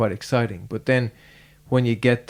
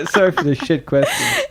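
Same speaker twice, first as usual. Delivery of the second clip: higher, said through real laughter; laughing apology; 8 semitones higher than usual. Real, not acted. Interviewer apologising to guest for a bad question, laughing.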